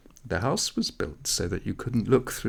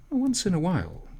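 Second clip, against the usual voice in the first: coy offhand reply; 11 semitones higher than usual. A narrator's reading. Mirele's evasive answer.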